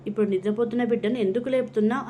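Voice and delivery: plain reading voice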